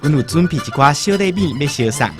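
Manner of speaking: ordinary talk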